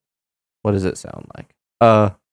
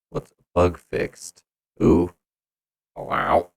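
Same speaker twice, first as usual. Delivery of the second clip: ordinary talk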